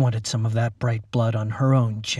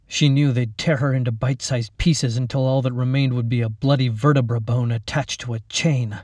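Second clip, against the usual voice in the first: ordinary delivery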